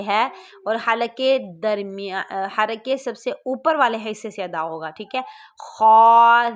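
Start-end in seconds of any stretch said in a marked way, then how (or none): 5.6-6.5 خا loud, thick from the top of the throat; louder corrected letter; thick heavy kha. Shown as a demonstration. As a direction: punch out a heavy خا. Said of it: "خا موٹی آواز میں پڑھیں گے".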